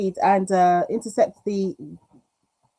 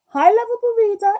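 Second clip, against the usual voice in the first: much higher, louder cheery hello; excited greeting; 6 dB louder than usual. Real, not acted. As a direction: greet them brightly. Greeting a viewer.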